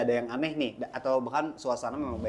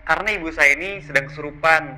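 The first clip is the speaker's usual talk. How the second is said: son seeking help; 4 semitones higher, 5 dB louder than usual. Acted. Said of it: "saya mau minta tolong".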